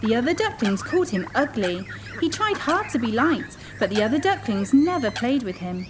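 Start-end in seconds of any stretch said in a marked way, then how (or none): none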